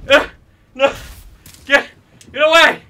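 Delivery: high, loud panicked yell; scared horror game streamer. Real, not acted. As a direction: yell it in real fright. Panicking at the animatronic.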